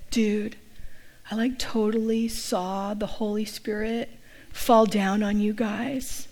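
soft, slow stoner-like awe; hushed, drawn-out retold wonder; awestruck dude husband. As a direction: imitate an amazed dude, drawing it out. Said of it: "her husband turned and said".

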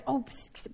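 whispered "psst, psst"; furtive, unvoiced psst; secretive psst. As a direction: hiss softly to get someone's attention. Imitating people pulling someone aside.

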